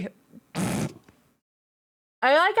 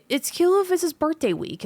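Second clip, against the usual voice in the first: cooing over dog; 6 semitones higher than usual. Real, not acted.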